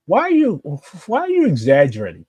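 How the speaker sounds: high-pitched exasperated why; exasperated retort. Real, not acted. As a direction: call out the exaggeration, incredulous. Arguing back at an online claim.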